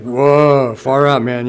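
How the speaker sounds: lightly voiced hippie, stretched whoa; a hint of a stoned seventies kid; old hippie slang. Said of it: "back then my language was like".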